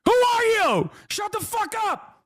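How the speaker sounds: high-pitched hammed-up telling-off, rising to a shout; mock angry outburst. Streamer mimicking himself snapping at a panel guest, high mock shout. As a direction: squeal it like a mock tantrum at a TV guest. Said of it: "I was like".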